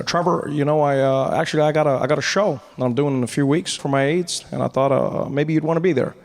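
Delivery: low, halting presidential voice; Obama. Acted. President inviting the comedian to his show, low and halting.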